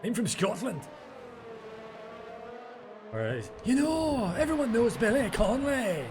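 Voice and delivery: high-pitched put-on Scottish accent; mock Scottish. After listing accents, he tries Scottish. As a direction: do a Billy Connolly-style Scottish accent. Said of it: "we haven't done the Scottish".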